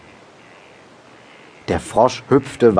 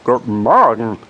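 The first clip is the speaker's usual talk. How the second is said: louder greeting from a second frog; pleased reply; 2 semitones higher, 5 dB louder than usual. A narrator's reading. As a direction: return the greeting, pleased and emphatic. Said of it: "erwiderte der andere erfreut". (erfreuter Gegengruß eines zweiten Frosches)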